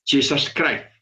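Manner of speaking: "Jesus Christ" as "tchissas kraif"; "Jesus Christ" the way they say it in American churches; English pronunciation. Shown as a demonstration. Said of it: "hay unos que dicen".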